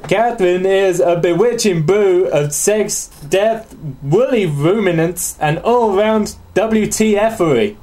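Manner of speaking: put-on slow Southern accent drifting into Australian; badly faked Australian accent. Host reading about a sex game, badly faked Australian accent. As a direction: draw it out in a poor Australian accent. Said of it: "I'm just doing Australian".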